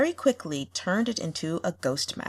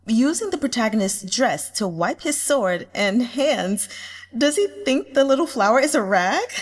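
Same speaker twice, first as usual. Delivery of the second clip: a notch higher and louder, giddy online reader; gushing fan comment; 4 semitones higher, 5 dB louder than usual. Acted. A giddy reader gushing over the villain, laughing. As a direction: read the comment like a thrilled fan girl laughing.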